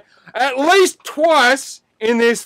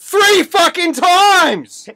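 emphatic insistence; 5 semitones higher, much slower than usual. Real, not acted. Insisting on count in argument.

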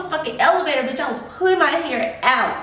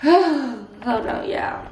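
normal talking voice

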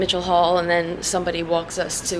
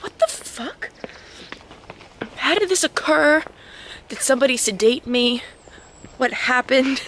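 confused; 4 semitones higher than usual. Retold or imitated. Confused quote of herself waking.

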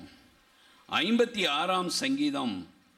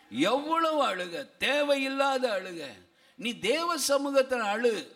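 raised, sustained sermon delivery; impassioned preaching; 6 semitones higher than usual. Acted. Preacher urging believers to weep in God's presence, impassioned. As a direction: exhort them phrase by phrase.

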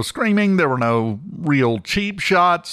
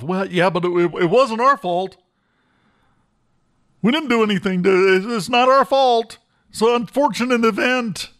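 higher-pitched imitation of someone making excuses; mock defensive excuse; 7 semitones higher than usual. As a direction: mimic a defensive excuse, pitch climbing as it goes. Mimicking excuses for the brawl.

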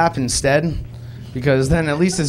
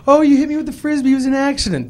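put-on much higher, fast child repeating; high, quick kid reciting the lie; small boy voice; 10 semitones higher, 3 dB louder, faster than usual. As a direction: rattle it off like a little kid. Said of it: "You know, like".

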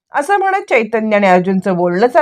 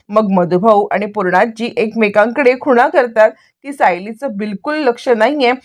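ordinary delivery, nothing marked

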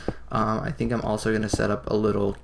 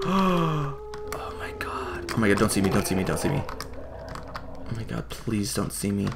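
hushed, breathless whisper, hoping not to be spotted; scared whisper; 3 semitones higher, 3 dB quieter than usual. Real, not acted. Gamer hiding from two flame ogres, frightened whisper. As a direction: whisper like the monster is right there.

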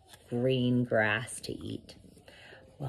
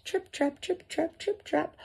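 high, soft trip-trap patter; tiny hooves tapping lightly on wood; little goat hoof steps; 14 semitones higher, 6 dB quieter than usual. A narrator's reading. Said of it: "feet made that sound on the bridge".

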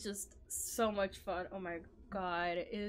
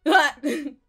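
loud exclamation; 8 semitones higher, 13 dB louder than usual. Real, not acted. Surprise during boss fight.

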